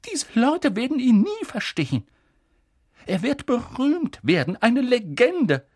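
high professor voice, faint at first, building loud; McGonagall, disbelieving then fervent. Acted. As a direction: start faint with disbelief, swell to passionate protest. (erst tonlos, dann hoch und leidenschaftlich)

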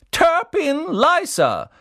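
slow, high, loud ceremonial call; hat calls a name. A narrator's reading. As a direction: announce it like the sorting hat. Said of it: "sorting hat calls name". (langsamer, lauter Ausruf des Hutes)

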